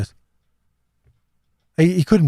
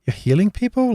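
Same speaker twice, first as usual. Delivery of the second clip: incredulous; 10 semitones higher than usual. Retold or imitated. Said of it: "scratching your head now going".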